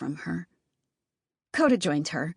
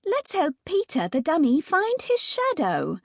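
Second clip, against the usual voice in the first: high hammed-up British accent; mock-posh British, playing Wendy; 10 semitones higher than usual. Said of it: "in my best British accent".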